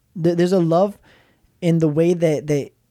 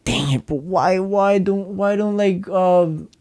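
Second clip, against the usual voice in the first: drawn-out higher-pitched mock exasperation; mock frustration; 3 semitones higher, slower than usual. Husband griping about how he talks to his wife, mock frustrated. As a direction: act out a mildly frustrated complaint.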